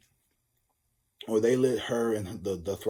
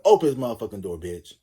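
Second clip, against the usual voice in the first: louder, barked order; loud demand; 8 dB louder than usual. Retold or imitated. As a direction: shout at him to open up. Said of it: "he's like".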